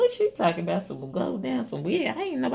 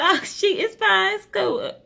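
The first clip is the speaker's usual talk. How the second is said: breezy brush-off; 13 semitones higher, 5 dB louder than usual. Retold or imitated. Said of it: "I said".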